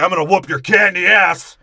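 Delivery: loud mock boast from the Rock; the Rock's boast. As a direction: bellow it like a wrestler trash-talking. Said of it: "The Rock's like".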